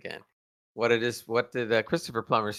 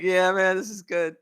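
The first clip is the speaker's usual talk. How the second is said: excited cheer; 7 semitones higher, 3 dB louder than usual. Retold or imitated. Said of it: "I was sitting there going like".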